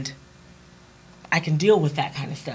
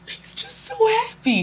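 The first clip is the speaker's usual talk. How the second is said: much higher, slow, choked-up voice; teary; slower than usual. Real, not acted. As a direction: let the tears catch the words. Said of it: "I'm a tear girl".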